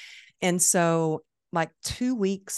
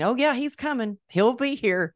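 eager reassurance; 8 semitones higher, 4 dB louder than usual. Retold or imitated. Reassuring the event planner.

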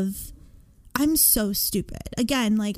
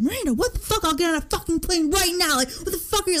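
a higher, hurried, worried father; alarmed dad; 6 semitones higher, faster than usual. Retold or imitated. Dad calling from Florida, mock frantic higher voice. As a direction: play the frantic dad on the phone.

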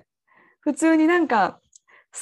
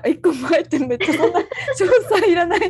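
laughing protest; 3 semitones higher, 4 dB louder than usual. Real, not acted. Embarrassed protest while laughing.

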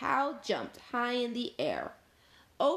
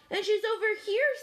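high, loud, quick call; rapid high-pitched shout; 13 semitones higher, 5 dB louder, faster than usual. A narrator's reading. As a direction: chime in urgently from elsewhere. Dog calls out another piece.

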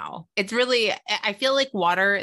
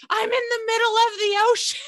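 very high, loud panicked cry; hammed-up wail of sudden terror; mock terror; 6 dB louder than usual. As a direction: retell it as a comic high-pitched freak-out. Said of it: "terror of".

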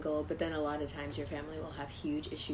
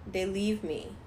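normal talking voice

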